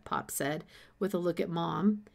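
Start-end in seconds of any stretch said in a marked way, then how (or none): none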